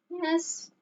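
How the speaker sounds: high, soft little yes; small voice. A narrator's reading. Franklin agreeing quietly with his father. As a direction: barely say it. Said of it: "in a small voice".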